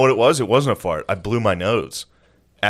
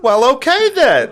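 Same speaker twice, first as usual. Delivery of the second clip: high, loud, slow comeback; mock huffy woman; 7 dB louder, slower than usual. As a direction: screech it as the thwarted woman. Imitates woman's flustered reply.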